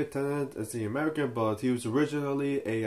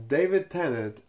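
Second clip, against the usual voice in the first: ordinary talk, nothing marked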